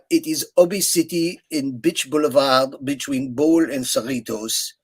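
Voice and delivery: put-on thick French-accented English on the phone. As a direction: imitate the French puppeteer's heavy accent. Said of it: "I have a very good French accent".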